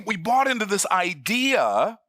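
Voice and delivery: ordinary talk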